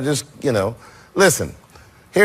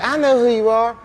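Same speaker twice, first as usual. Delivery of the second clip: mimicking [name]; 8 semitones higher than usual. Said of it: "she was like".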